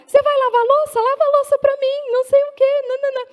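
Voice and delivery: high, loud mocking caricature of scolding; nagging wife. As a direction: badger him in a piercing high voice. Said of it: "a mulher vem atrás". (imitação de mulher cobrando, voz aguda)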